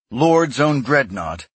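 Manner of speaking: ordinary delivery, nothing marked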